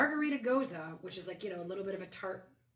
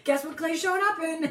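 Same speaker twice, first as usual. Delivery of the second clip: laughing; 8 semitones higher, 6 dB louder than usual. Real, not acted. Joking about costume, laughing.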